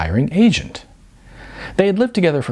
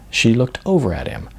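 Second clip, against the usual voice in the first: plain reading voice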